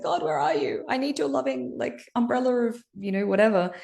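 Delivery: half-joking cry for comfort from above; high whiny plea, lightly self-mocking; pleading with God. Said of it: "I'm always like".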